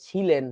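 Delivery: ছিলেন starting with "chh", not s; wrong chh pronunciation. Shown as a demonstration. Teacher warning against ছ, ছিলেন as "chhi-len". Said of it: "ছ দিয়ে লেখো না".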